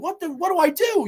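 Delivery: high-pitched imitation of panicking; hammed-up flustered question; mock panic. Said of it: "so he starts fucking panicking".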